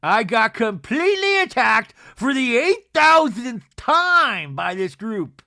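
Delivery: high, loud, hammed-up wail; imitated melodramatic meltdown; mock crying. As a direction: wail it as a tearful protest. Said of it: "she's, like, crying".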